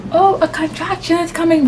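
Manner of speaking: high mock wail; re-enacted labour panic. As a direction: announce the pain in a high plea. Re-enacts next contraction arriving.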